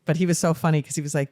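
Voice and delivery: ordinary delivery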